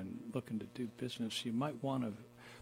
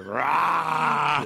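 loud roaring shout; lion roar; 5 semitones higher, 15 dB louder than usual. Pretend. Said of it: "and you were".

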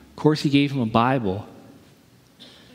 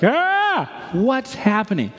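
much higher yelp, then a slow frightened question; startled shriek as the suitcase flies; mock terror; 7 semitones higher, much slower than usual. Passenger watching the suitcase fly, mock panicked cry. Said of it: "it's like".

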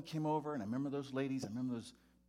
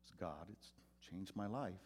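usual speaking voice

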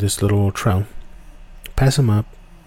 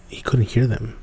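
normal talking voice

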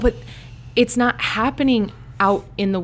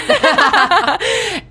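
high burst of real laughter; laughing; 14 semitones higher, 7 dB louder than usual. Real, not acted. Guest laughs about her Chicago accent, real laughter.

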